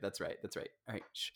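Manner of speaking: soft unvoiced whisper. Real, not acted. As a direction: whisper it so everyone hushes. Shushing for absolute silence.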